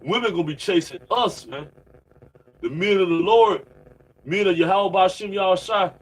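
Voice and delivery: ordinary delivery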